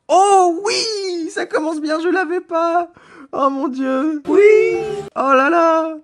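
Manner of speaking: high-pitched squeals of joy, very loud; ecstatic shouting. Real, not acted. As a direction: scream with real delight at a lucky find. Pulls a wanted card.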